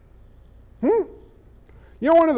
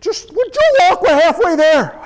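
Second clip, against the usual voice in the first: very high, louder mock exasperation; exasperated high voice; 7 semitones higher, 6 dB louder than usual. A disciple shushing his nagging friend, high and exasperated. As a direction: squeal it out impatiently.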